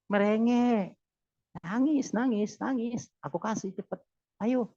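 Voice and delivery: God's answer. Retold or imitated. Preacher voices God urging the believer to plead, lightly voiced coaxing. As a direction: coax them to whimper, lightly voiced. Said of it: "Kata Allah".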